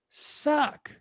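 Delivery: ordinary talk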